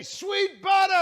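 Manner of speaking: high-pitched street heckle; mock scream. Fans calling out at the actor in the street, mock screaming. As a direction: holler it high like a fan across the street. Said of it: "people scream at me in the street".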